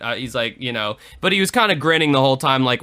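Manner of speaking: ordinary talk, nothing marked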